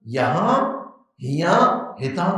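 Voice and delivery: یہاں، ہیاں، ہیتھاں each with a rising end; dialect variants listed. Shown as a demonstration. Teacher listing regional variants of one word. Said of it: "ہے وہی لفظ".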